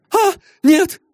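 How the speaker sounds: high, tearful cry of denial; a frightened girl crying no; crying young lady. Acted. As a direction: sob the denial out. Girl cries out under questioning.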